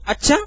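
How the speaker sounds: "अच्छा" short and high, rising at the end; questioning अच्छा. Shown as a demonstration. Teacher showing students ways to say one word, questioning. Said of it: "ये भी sir बोलने का तरीका".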